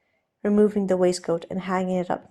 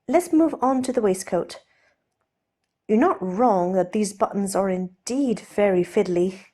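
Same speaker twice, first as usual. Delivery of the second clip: raised, lighter voice for the nervous aristocrat; Steed, flustered gentleman; 3 dB louder than usual. Acted. Steed offering to undo Ed's cravat, nervously polite. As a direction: play Steed, polite and a little jittery.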